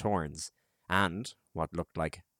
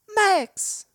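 much higher, louder call; 8 dB louder than usual. A narrator's reading. Lila calls the boy over to her, loud. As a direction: shout the boy's name to bring him over. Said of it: "Lila called".

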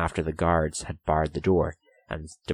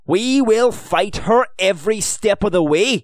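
loud, high-pitched defiant vow; fierce; 12 semitones higher, 7 dB louder than usual. A narrator's reading. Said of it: "he said fiercely".

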